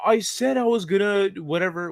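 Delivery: high-pitched mock protest; put-on whiny comeback from a mad friend; retold mad friend. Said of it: "He'd come back all mad".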